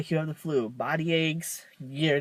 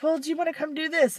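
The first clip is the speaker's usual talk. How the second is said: higher and fast, asking; mock mom; 9 semitones higher, faster than usual. Mom asks her daughter to come do a chore. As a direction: rattle off mom's request brightly. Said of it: "my mom's like".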